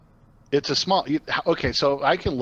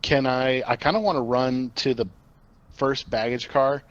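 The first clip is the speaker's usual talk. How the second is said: normal talking voice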